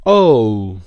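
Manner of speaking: O drawn out loud as a gliding diphthong. Shown as a demonstration. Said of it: "o diphthong هست".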